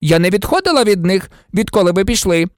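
quick, high-pitched protest; indignant. A narrator's reading. Nurse insisting she never left the pupils, indignant. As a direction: defend yourself in offended haste. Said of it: "обурено заперечила мадам Помфрі".

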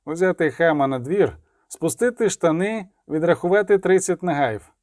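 ordinary delivery, nothing marked

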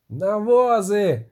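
high, drawn-out rallying call; shouted order. A narrator's reading. Kozojed ordering his men to the wagons, high shout. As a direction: stretch it out as a battle command. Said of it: "крикнув козоїд".

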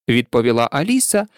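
usual speaking voice

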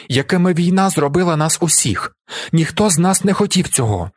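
high, urgent appeal to jurors; impassioned shouted plea. A narrator's reading. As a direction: plead for a comrade with conviction. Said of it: "кричу я".

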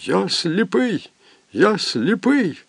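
slow, high, a touch of sobbing. Acted. Unseen voice in the dark room, lightly sobbing. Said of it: "чийсь голос схлипував".

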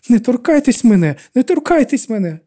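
screaming at Miriam to back off; panicked shout. A narrator's reading. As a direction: shout it as she loses her balance. Panicked woman pushed by Miriam.

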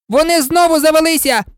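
high-pitched yell; full-voiced shout. A narrator's reading. Messenger promised a whisper to the king, shouts instead. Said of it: "гонець закричав на весь голос".

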